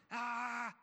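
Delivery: high, sustained mock yell; imitated scare yell. Security guard slapping machete to scare robbers, imitated high yell. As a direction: holler to scare them off, high and held.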